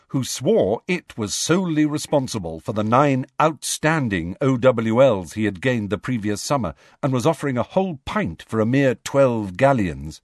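ordinary delivery

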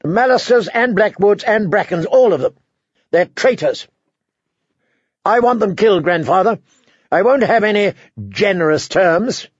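put-on a spoiled young tyrant insisting; petulant boy king. A narrator's reading. Joffrey voiced demanding killings.

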